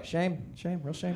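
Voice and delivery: soft, sorry shame, shame; mock pity. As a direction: murmur it like sad news. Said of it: "be like".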